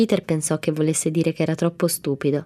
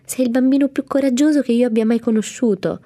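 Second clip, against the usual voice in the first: higher and louder, worked-up protest; heated insistence; 8 semitones higher, 5 dB louder than usual. A narrator's reading. Mamie flustered, insisting to Peter, higher and louder. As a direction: insist hotly he's braver. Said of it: "lei si innervosì".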